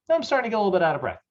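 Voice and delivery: lightly voiced client, high and very fast; treadmill client. Client answering trainer on the treadmill, lightly acted client. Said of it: "throw your client on the treadmill".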